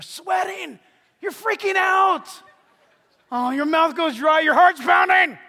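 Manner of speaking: high, loud and slow, building panic; panicked shout. Acted. Pastor acting out the fear of obeying God, loud panic. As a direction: shout it like your heart's about to burst.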